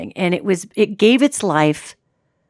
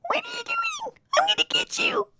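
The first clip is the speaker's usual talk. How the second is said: tiny squeak, far higher and much softer; squeaky mouse demon; 10 dB quieter than usual. Acted. Shrunken demon squeaking threats at the deer, tiny high squeak. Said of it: "squeaking like a little mouse".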